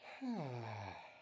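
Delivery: long audible sigh. A narrator's reading. Tammy on her past breakup, sighing. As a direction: let out a drawn-out sigh. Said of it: "with a sigh".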